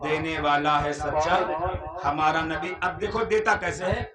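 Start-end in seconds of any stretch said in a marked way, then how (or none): 0.0-2.8 slow, low poetic recitation; recited couplet. Acted. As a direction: draw the verse out slowly. (धीमी शायराना अदायगी)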